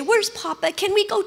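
put-on a small child asking for Dad and Mom; imitating a little girl. Daughter begging grandparents for her parents, a pleading little-girl voice. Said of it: "And then she'd say".

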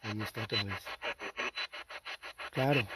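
very soft, low murmur; barely voiced, telling a companion it's back; hushed aside. Real, not acted. Hushed aside, hears it again.

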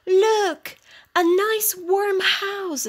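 delighted cat, drawing out the words; excited cat. A narrator's reading. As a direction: point out a wonderful find, pitched well up. Cat spots house at night.

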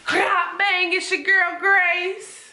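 high, slow imitation of the intro; mock intro. Copies the intro catchphrase high.

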